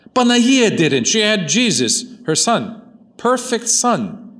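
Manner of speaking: high-pitched, insistent pulpit voice; impassioned preaching. Real, not acted. Preaches with raised voice.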